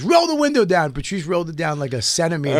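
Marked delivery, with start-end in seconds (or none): 0.0-0.9 high, loud command; cop barking. Retold or imitated. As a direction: bark at the driver. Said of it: "he goes".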